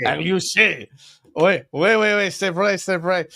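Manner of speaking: put-on Agliouche, then "Oui, oui, oui, c'est vrai" in a hammy French accent; hammed-up French accent. Playing a Frenchman for his co-host, in a French accent. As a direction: camp it up like a Frenchman. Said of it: "Can you say that in French accent?".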